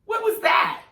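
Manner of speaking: high, loud squawk of disbelief; shocked disbelief. Acted. Reacting to [name] saying "Canadia", shocked disbelief. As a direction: shout it sky-high, utterly baffled.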